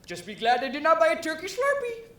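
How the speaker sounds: loud, high-pitched imitation Indian accent; mock Indian accent. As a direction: put on an exaggerated Indian accent. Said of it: "do an accent and say".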